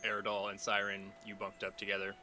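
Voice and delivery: calm scene-setting narration; game master narration. A narrator's reading. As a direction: set the morning scene softly.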